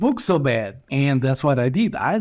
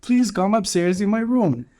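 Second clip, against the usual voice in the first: higher, eager mock voice of the mother; imitating the mother; 7 semitones higher than usual. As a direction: lift your voice and sound inviting. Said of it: "She's like".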